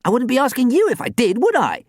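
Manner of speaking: high, loud, indignant snap; fierce retort. A narrator's reading. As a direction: throw it back fiercely. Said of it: "Sam snorted fiercely".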